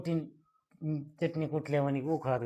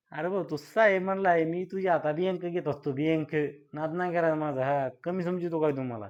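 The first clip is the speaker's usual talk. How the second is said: loud, higher swagger; flattered man boasting after being called sir; boastful; 3 semitones higher, 4 dB louder than usual. Acted. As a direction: puff yourself up and brag. Puffed-up man boasts loudly.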